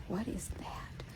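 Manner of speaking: breathy whispered voice for the dog's worry; horse's whispered thought. Retold or imitated. Owner voicing her horse's puzzled thought, whispered. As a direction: whisper it like a wary horse asking about the bridge. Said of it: "He's like".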